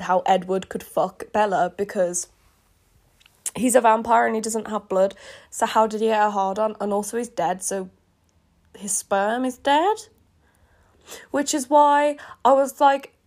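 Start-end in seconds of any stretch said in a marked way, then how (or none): none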